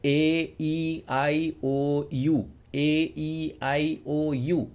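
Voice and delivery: slow, lifted feminine vowel drill; front-of-mouth vowels. Shown as a demonstration. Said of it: "इस तरह से आप इन स्वरों का उच्चारण करें". (ऊँचे और हल्के स्वर, धीरे-धीरे)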